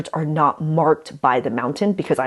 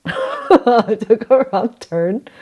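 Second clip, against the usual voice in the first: laughing; 6 semitones higher, 4 dB louder, much slower than usual. Real, not acted. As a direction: repeat it through a slow laugh, higher.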